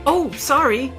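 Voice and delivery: high, loud startled apology. A narrator's reading. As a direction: apologize with a jump in the voice. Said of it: "jumped when she saw me".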